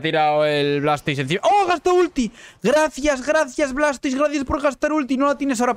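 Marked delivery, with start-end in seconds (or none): 1.4-2.3 high, loud burst of glee; gleeful shout. Real, not acted. Gleeful at enemy wasting ultimate. 2.6-5.8 high mocking thank-you; sarcastic thanks. Real, not acted. Mocking enemy's wasted ultimate.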